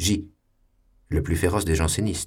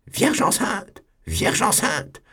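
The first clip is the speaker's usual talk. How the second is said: much higher, shrill-pitched outburst; bursting out; slower than usual. Acted. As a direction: burst out Vierge enceinte in scandal. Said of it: "il éclata".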